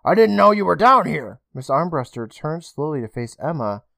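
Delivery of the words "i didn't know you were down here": higher, breathless relief; relieved startle. Acted. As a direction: shaken but relieved. Emma relieved after fright.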